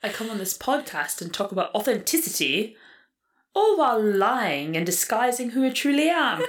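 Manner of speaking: British accent, mock solemn confession; a hammed-up British confession. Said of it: "You sound like someone off of Call the Midwife".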